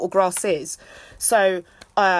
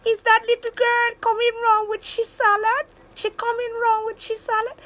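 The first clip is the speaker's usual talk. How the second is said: high put-on mum voice, questioning; imitating mum; 12 semitones higher than usual. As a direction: play mum fussing over cheese salad. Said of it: "my mum would be like".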